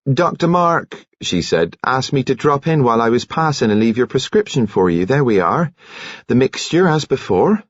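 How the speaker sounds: a touch of bustling kindly nurse; brisk cheery nurse. Acted. Nurse delivers the commander's prescription, brisk and cheery. Narrator voices Nurse Kettle.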